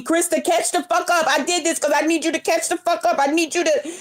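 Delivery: a hint of a bossy friend scolding; mock scolding friend. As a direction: playfully imitate a friend snapping at her to hurry up. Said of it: "Ever since you told me".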